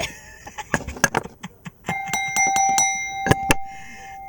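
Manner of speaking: long, high, loud burst of laughter; loud cackle. Real, not acted. Reader gloats over a backfired attack, high cackle.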